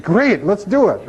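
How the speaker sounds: mock-enthusiastic agreement through a laugh; eager laughing yes. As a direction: jump at the offer, laughing. Eager reply to wiretap offer.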